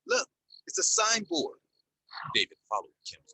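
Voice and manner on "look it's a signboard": high, loud, joyful cry. A narrator's reading. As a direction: call it out with relief. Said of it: "Kim cried in joy".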